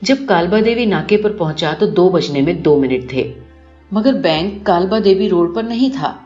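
plain reading voice

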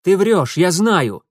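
loud forced confidence; bluffing snap. A narrator's reading. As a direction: cover doubt with bravado. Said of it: "скрывая неуверенность, бросил Митя".

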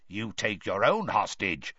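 lightly voiced stern merman; a hint of a cold merman. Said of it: "one of them said to him".

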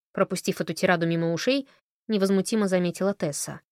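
ordinary delivery, nothing marked

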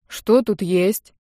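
slow, drowsy voice; groggy, dragged-out question; sleepy. A narrator's reading. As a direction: mumble it as if just waking. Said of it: "раздался сонный голос брата".

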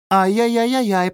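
put-on high-pitched toddler voice; tut-tut like a small child; scolding little girl. A narrator's reading. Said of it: "погрозила Уве пальчиком младшенькая". (детский укоризненный голосок)

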